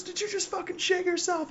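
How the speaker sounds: very high, thin mock holler; imitated yell. Other gunner yells over to the medic, high mock yell. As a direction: holler the question far up in pitch. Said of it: "the gunners are just yelling at each other".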